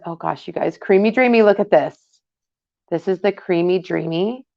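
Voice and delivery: usual speaking voice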